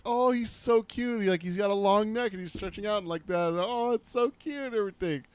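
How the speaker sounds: dad mimics daughter adoring the creature; imitating gushing daughter. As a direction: squeal it like a smitten ten-year-old. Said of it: "and she's like".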